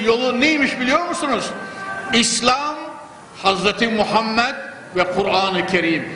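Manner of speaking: usual speaking voice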